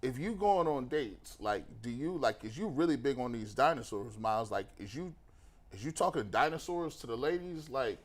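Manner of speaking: deep put-on voice. Asking Myles about dinosaurs on dates, in a deep mock reporter voice. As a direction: drop the voice way down to tease.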